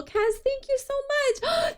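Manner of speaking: high, slow, touched thank you; gushing thanks. Real, not acted. Streamer thanking Kaz for a cinnamon roll, gushing. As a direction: stretch out the name, overwhelmed.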